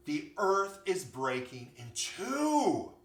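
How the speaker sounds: loud, slow, drawn-out warning; alarmed animals. A narrator's reading. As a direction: proclaim the disaster, stretching each word.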